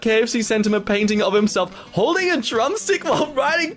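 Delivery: high giggling words breaking into laughter; giggly, delighted read; laughing through it. Real, not acted.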